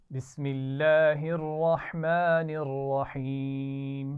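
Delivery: teacher chanting Quran for students to echo; Quranic recitation. Shown as a demonstration. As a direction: model the verse slowly for the class to repeat. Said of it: "मेरे बाद पढ़िए".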